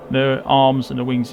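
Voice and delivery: ordinary talk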